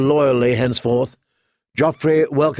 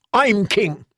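angry; 7 semitones higher than usual. A narrator's reading. Boy king in a rage.

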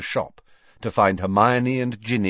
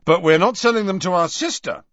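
raised, louder big-brother objection; stern; 10 semitones higher, 6 dB louder, faster than usual. A narrator's reading. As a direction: put your foot down as a brother. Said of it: "becoming suddenly stern".

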